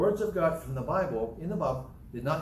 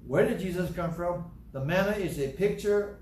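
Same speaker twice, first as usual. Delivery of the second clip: ordinary delivery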